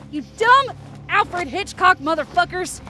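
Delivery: high, exasperated yell; frantic, high-pitched fury; angry cursing. Acted. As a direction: curse out the attacking birds. Cursing the attacking birds.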